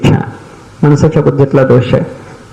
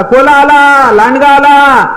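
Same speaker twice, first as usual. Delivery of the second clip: high mock cry of a prankster boy; shepherd boy crying wolf; 10 semitones higher, slower than usual. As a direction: yell it like a boy pranking the village. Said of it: "मोठमोठ्याने ओरडायचा".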